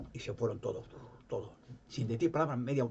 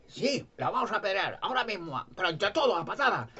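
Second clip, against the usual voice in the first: mocking glee; 8 semitones higher than usual. Pharisees mock Jesus' imagined verdict.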